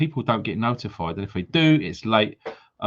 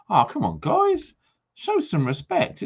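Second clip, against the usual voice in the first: mock scolding; 10 semitones higher, slower than usual. Real, not acted. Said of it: "scolding viewers for thumbs up".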